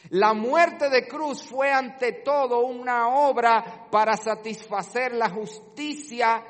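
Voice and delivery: loud, high, impassioned pulpit preaching; fiery sermon peak, slowing on key words; preaching at full force. Acted. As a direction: drive the point home to the congregation. Preacher escalates on propitiation.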